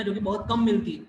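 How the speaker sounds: ordinary talk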